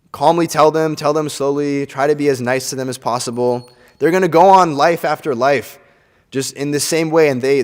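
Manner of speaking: ordinary delivery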